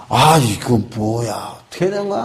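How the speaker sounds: weary griping, words stretched out; grumbling soldier. Acted. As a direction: complain like a soldier dragged out of bed. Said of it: "불평, 원망이 많아요".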